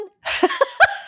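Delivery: real laugh bursting out; airy high-pitched giggle. Real, not acted. Laughing at the memory.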